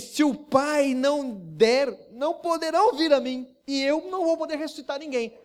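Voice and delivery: Jesus proclaiming in the synagogue; bold declaration. Acted. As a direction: proclaim it with authority.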